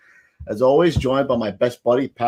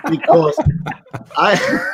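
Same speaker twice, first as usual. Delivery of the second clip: laughing; slower than usual. Real, not acted. Laughing breakdown.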